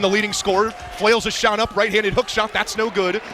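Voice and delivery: ordinary talk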